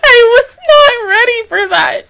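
high, loud, crying complaint; tearful protest. Real, not acted. Viewer caught off guard by the episode, tearful protest.

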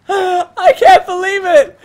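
laughing hysterically, loud and high; raging gamer. Real, not acted. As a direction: shriek with laughter in disbelief. Gets killed, laughs hysterically.

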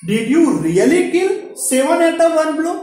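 high, loud, slow wondering question; amazed king asking. Acted. King questioning little Gopal in court, amazed and high. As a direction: ask it like a king who can hardly believe it.

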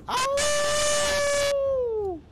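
high, loud mock wolf howl. Answering the howling wolves with a mock howl. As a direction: throw your head back and howl like the wolves. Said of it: "you hear them motherfucker howling".

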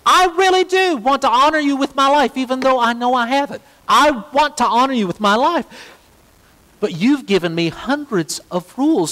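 a hint of a desperate believer pleading; pleading prayer. Preacher voicing a sinner's prayer, mildly pleading.

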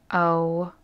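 ordinary talk, nothing marked

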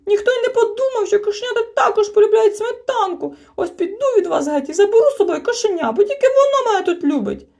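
very high, loud screeching outburst; shrieking tantrum. A narrator's reading. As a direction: shriek like an offended little one. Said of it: "заверещав". (верескливий крик)

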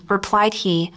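ordinary delivery

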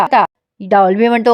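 usual speaking voice

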